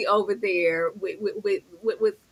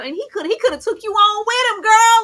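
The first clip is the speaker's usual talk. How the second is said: high, loud mock scolding; 11 semitones higher, 6 dB louder than usual. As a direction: scold her like a sassy friend. Scolding a character.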